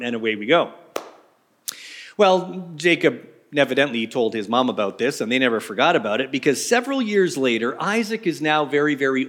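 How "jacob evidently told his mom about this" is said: normal talking voice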